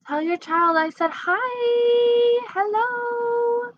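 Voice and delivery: high, drawn-out sing-song hello. Real, not acted. Streamer says hi to a viewer's child, high cooing greeting.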